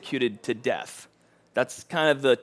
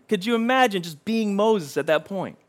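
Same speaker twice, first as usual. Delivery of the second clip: normal talking voice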